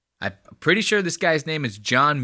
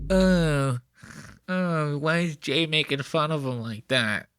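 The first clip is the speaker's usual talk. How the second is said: a hint of a fretful, softer whine, mocking the guitarist; mock upset bandmate; 2 semitones higher, 3 dB quieter, slower than usual. Vlogger mocking the guitarist's disapproval: lightly voiced fretful whine. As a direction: play the prissy offended bandmate, lightly. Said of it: "He's sitting there like".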